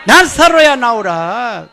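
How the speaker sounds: stronger. Shown as a demonstration. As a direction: push it harder this time. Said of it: "더 세게 해보겠어요".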